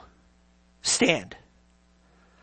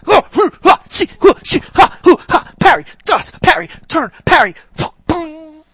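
loud, higher fight grunts hoo and ha; mock sword fight; 7 semitones higher, 9 dB louder than usual. Preacher mocks Christians swinging swords, loud hammed-up grunts. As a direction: ham up a clumsy sword battle.

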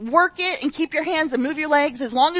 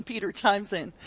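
ordinary talk, nothing marked

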